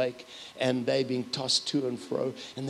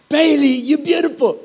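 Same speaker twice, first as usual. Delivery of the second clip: higher and louder sing-song praise; playful loud praise; 12 semitones higher, 11 dB louder than usual. Retold or imitated. Grandpa playing the compliment game with granddaughter, loud and high. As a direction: call it out like a game you both know.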